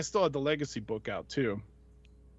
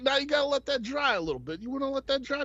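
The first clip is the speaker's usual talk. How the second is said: high Barbarino-style wise-guy voice; much higher New York voice; artist's voice; 11 semitones higher than usual. Retold or imitated. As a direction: ask it like a Brooklyn tough guy. Voicing the artist.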